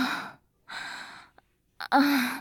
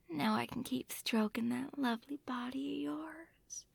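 hushed caressing whisper; 2 semitones higher, 11 dB quieter than usual. Acted. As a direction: whisper it very softly.

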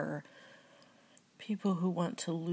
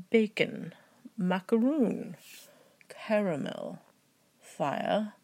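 put-on British accent; 3 semitones higher, 5 dB louder, slower than usual. Trying the word list in a British accent for fans, mock British. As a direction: try out a hammed-up British accent. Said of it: "I'm going to try a British accent".

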